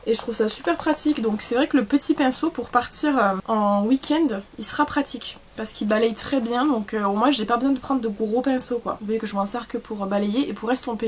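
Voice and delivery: normal talking voice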